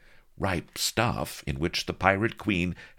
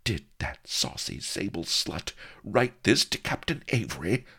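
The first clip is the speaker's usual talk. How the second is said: higher, incensed question; gnashing; 6 semitones higher than usual. A narrator's reading. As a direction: demand it through gnashing teeth. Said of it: "she gnashed".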